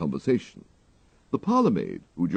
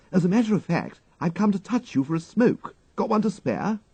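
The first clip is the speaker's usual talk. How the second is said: put-on higher, breezy young toff; young gentleman Wooster; 13 semitones higher than usual. A narrator's reading. Wooster asks his valet for a cigarette, breezy toff voice. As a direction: cadge a smoke lightly, upper-class chap.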